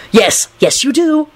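rising, urgent insistence; desperate. A narrator's reading. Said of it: "Lupin said desperately".

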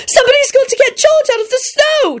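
put-on very high-pitched yelling child. A narrator's reading. Ginny alerts Lupin about George, yelling. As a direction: yell it right up high. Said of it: "Ginny yelled at Lupin".

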